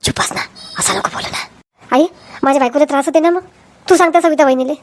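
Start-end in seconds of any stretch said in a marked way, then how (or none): 0.0-1.4 hushed, urgent whisper. Acted. Husband hushing his sister-in-law in an urgent whisper. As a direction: whisper it so the patient can't hear.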